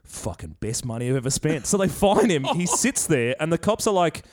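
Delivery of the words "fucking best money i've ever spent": lower, softer smug aside; smug defiant bloke. Retold or imitated. Bloke fined by cops, low smug shrug. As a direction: shrug off the fine, low and quiet. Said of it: "he's like".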